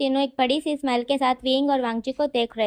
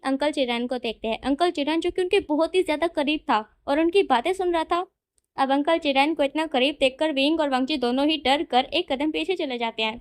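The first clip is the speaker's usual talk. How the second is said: usual speaking voice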